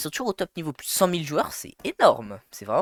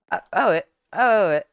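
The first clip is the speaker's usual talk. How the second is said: ordinary delivery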